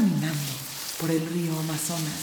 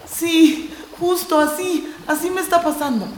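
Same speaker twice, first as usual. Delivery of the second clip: high, fast, louder urgent words; urgent agreement; 8 semitones higher, 4 dB louder than usual. Acted. Core realizing his fear, high and rushed. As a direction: blurt it out in alarm.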